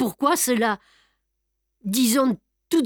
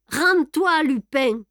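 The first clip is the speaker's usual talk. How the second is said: much higher, loud shouted order; yelled again; 5 semitones higher, 4 dB louder, slower than usual. A narrator's reading. Ganimard repeats his order to Lupin, loud shout. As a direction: bark the demand a second time. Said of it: "hurla Ganimard".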